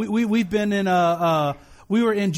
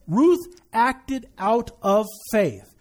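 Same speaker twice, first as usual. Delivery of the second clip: "Ruth acted out of faith" slowly, word by word; emphatic repetition; much slower than usual. Real, not acted. Preacher driving home the key to the congregation, "Ruth acted out of faith" slow and emphatic. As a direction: space out "acted out of faith" with weight on each word.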